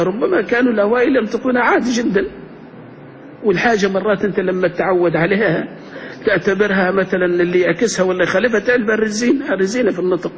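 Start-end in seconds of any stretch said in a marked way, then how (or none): none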